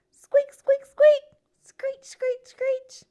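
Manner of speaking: very high, loud squeaky squeals; hamster squeaks. A narrator's reading. Hamster squeaking at the frog, high loud squeals.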